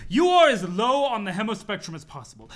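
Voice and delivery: loud, high, scandalized outburst; outraged shout. Acted. Noble snob yelling in outrage at the lowblood. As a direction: shriek your disgust at a lowblood.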